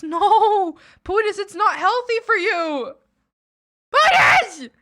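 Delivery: high, loud exasperated call of the name; scolding. Real, not acted. Streamer scolds Pootis for staying up, loud high scolding. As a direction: scold a friend who won't sleep.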